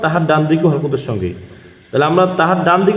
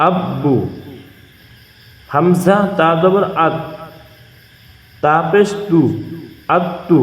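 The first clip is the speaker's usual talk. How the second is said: slow recitation drill. Shown as a demonstration. Teacher showing viewers how to read tashdid with pesh, slow drill chant. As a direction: spell it out like a Quran teacher, falling on আব্বু. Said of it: "দেখিয়ে দেবো".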